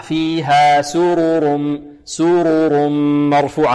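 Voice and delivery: سرر slow and chanted, each ر given its vowel; model reading. Shown as a demonstration. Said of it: "هكذا".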